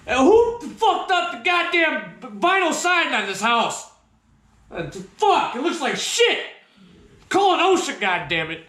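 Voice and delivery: high, indignant re-enacted outburst; mad outrage. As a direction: complain as if freshly woken and furious. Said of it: "same tone of voice I made".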